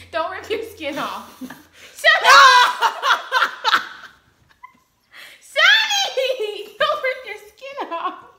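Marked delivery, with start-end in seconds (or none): 1.4-3.9 loud high laughing yell; playful shout. Real, not acted. 5.4-8.2 loud high laughing call; laughing warning; laughing shout. Real, not acted. Calling partner while laughing.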